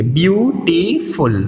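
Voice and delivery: Beautiful broken slowly into view, टी, फुल; syllable by syllable. Shown as a demonstration. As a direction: sound out beautiful in three separate, stretched beats. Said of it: "हमारे सामने ब्यू". (Beautiful को ब्यू-टी-फुल तोड़कर बोलें)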